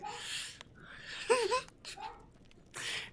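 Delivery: high, wheezy laughing fit; real helpless giggling. Real, not acted. Reader still cracking up mid-story, helpless laughter. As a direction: keep laughing until the breath runs out.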